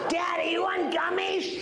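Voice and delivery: put-on high, slow little-kid voice; child accent. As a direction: play a small child begging daddy. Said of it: "that's a child accent".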